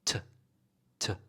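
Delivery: "To" reduced to a short "tuh"; weak form of to. Shown as a demonstration. Teacher demonstrating the weak form, "To" as a brief tuh. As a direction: cut "To" down to t plus a tiny schwa. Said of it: "and the weak form is".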